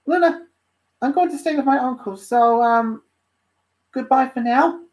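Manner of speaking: high, loud character voice, faltering goodbye; hesitant young Dante. Acted. Dante saying goodbye to Luna, high and hesitant. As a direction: pitch it up, pausing before the farewell.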